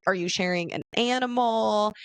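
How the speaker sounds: ordinary delivery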